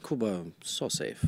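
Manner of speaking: low, hushed, unhurried reassurance; soft theater dude. Retold or imitated. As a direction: imitate a gentle, softly adamant host. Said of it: "like, a little soft".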